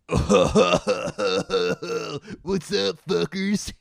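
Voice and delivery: high, loud mock Californian; California accent. Demonstrating California, high loud greeting in accent. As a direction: greet them as a caricature Californian. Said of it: "California accent".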